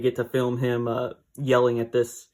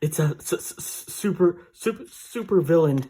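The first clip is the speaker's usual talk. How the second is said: tongue-tied thrill at finally meeting a villain; eager stammer building to super villain; stammering with excitement; 4 semitones higher than usual. Retold or imitated. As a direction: stutter over the s, rising a touch on super. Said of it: "He's so excited".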